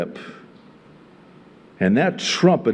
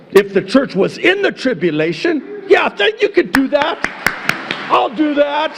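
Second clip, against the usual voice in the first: high, raised preaching voice; worked-up yell from the pulpit; 12 semitones higher, 3 dB louder, faster than usual. Real, not acted. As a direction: call it out high and forceful. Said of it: "I'm sorry, I'm yelling".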